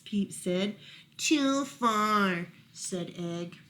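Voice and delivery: gentle storytime reading with voiced lines; reading to children. A narrator's reading. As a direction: read it to little ones at bedtime. Reading Peep and Egg aloud.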